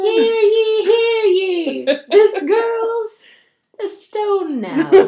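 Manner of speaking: loud, high hammed-up hear ye; town crier. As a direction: bellow the news to the village square. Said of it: "a town crier being like".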